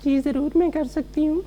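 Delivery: high, soft feminine voice, quick; man doing a woman's voice. Shown as a demonstration. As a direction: speak like a young woman. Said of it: "थोड़ी और बातें करेंगे मुझसे लड़की की आवाज़ में".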